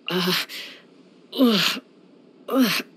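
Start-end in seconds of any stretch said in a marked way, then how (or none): 1.4-2.8 disgusted ugh. Acted. Disgusted by the food.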